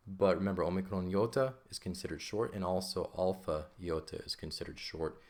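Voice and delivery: ordinary talk, nothing marked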